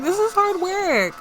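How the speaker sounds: high-pitched mock whine; playful complaint. Teased woman complains to her workmate about the job, playfully whiny. Voicing tired Sim complaining.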